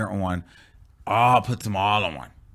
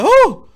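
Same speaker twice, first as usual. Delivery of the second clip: loud, very high whoop; mocking "whoo"; 14 dB louder than usual. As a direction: exaggerate a jumpy onlooker's squeal. Mocks friend's jumpy reaction.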